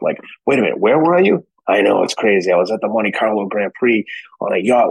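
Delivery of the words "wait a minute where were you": mock bafflement. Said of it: "like Forrest Gump".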